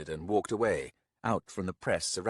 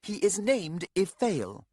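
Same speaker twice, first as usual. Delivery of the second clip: higher, slow curt reply; scowling woman; 11 semitones higher, 4 dB louder, slower than usual. Acted. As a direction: clip it with a scowl. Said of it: "Scowling, Coria said".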